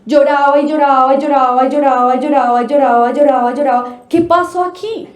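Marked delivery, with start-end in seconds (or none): 0.0-3.8 fast, running list of lloraba; rapid repetition. Shown as a demonstration. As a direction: rattle off each lloraba fast without pausing. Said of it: "la niña no paraba de llorar".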